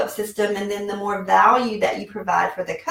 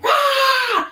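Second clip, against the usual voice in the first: raging roar. Retold or imitated. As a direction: let out a wild shout like a lunatic. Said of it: "I'm like a raging lunatic".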